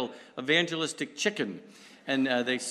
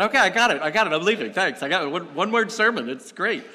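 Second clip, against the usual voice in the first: louder, higher mock brush-off; mock impatient listener; 5 semitones higher, 7 dB louder, faster than usual. Listeners brushing off the one-word sermon, mock impatient. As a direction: play an impatient listener leaving. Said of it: "some of you said".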